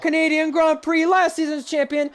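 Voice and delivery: high, loud winner announcement; victory call. Real, not acted. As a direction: build to the finish-line call. Calling the race win.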